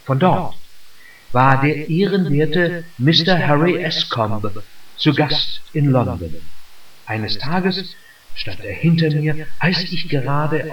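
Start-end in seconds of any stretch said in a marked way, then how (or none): none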